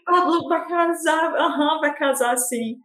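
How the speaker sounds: high-pitched imitation of excited fans; mock astonished gossip. Imitating fans reacting to the engagement rumour, mock excited.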